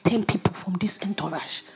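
unvoiced pleading whisper; whispered plea. Whispering to the usher about the entourage. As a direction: whisper it like a shy request.

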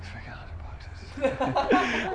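laughing whisper, drawn out slowly; whispered disbelief. Retold or imitated. To herself at the car wash, slow laughing whisper. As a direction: breathe it out in disbelief at the cost.

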